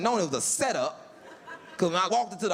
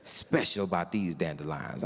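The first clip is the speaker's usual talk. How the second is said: slow, lower, hushed reverence; lightly voiced dreamy neighbor; mock reverent; 11 semitones lower, 3 dB quieter than usual. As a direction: confide it like a sacred secret. Tim's reverent dandelion talk.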